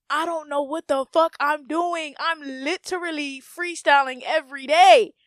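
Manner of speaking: ordinary talk, nothing marked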